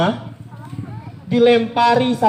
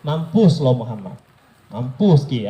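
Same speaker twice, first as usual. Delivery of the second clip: low mocking curse; 9 semitones lower than usual. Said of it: "Kalau artinya".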